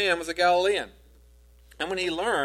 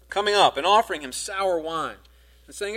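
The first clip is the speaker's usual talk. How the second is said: ordinary talk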